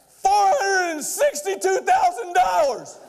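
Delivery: slow shout of the total; slow shouted reveal. Real, not acted. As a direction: land the big number like a punchline. Revealing the shocking figure.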